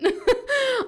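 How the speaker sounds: high, loud giggling laugh; burst of laughter. Real, not acted. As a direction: let a delighted laugh burst out. Giggle after admitting love of accents.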